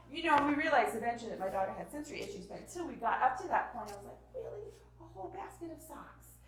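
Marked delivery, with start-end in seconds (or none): 4.3-6.4 high, hushed incredulity; sarcastic disbelief. Retold or imitated. Exasperated mom facing daughter's sock fuss, sarcastic. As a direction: question it softly, pitched up in disbelief. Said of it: "you wanna be like".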